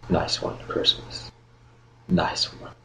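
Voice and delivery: hushed, voiceless jibe; secretive muttered whisper; dry whisper. Acted. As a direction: whisper it at a clumsy companion. Sarcastic whisper to clumsy fellow captive.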